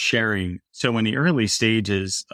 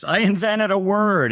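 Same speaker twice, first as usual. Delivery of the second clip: high, drawn-out imitation of a gleeful little girl; giggly child, imitated; 11 semitones higher, 3 dB louder, slower than usual. Dad retelling his giggling daughter's announcement, high and slow. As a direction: lightly imitate a child bursting with her invention. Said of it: "saying it through deep laughter".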